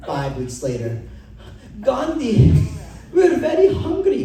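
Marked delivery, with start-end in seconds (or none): 1.8-4.2 "we're very hungry" in a loud, higher, hammed-up Indian accent; hungry followers' mock Indian accent. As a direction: plead in an exaggerated Indian accent. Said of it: "my accent's racist".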